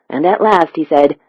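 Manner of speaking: ordinary delivery, nothing marked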